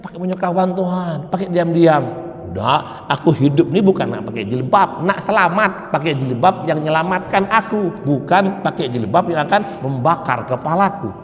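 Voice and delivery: ordinary delivery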